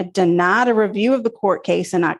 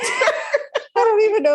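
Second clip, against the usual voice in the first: laughing; 11 semitones higher than usual. Real, not acted. Still laughing.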